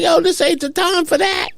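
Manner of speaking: frantic inner protest; panicked protest. Retold or imitated. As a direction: plead fast that now is not the moment. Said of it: "I'm like".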